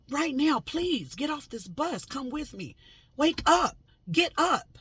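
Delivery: high, loud urgent yelling. Retold or imitated. Begging the lady to wake up and get off, yelling. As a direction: shout her awake, pleading. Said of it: "forgive me if I'm loud".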